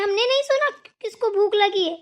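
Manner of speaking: usual speaking voice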